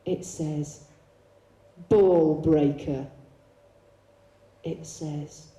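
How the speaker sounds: measured poetry recital with long pauses; solemn, spaced-out performance reading; spoken-word poem. Acted. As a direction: recite it deliberately, line by line. Recites own piece about friend.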